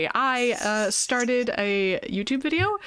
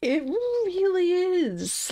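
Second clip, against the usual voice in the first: drawn-out spooky agreement; 9 semitones higher, much slower than usual. Real, not acted.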